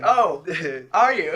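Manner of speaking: mock surprise. Bystander answers "I'm Batman", loud mock surprise. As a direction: feign being amazed, loudly.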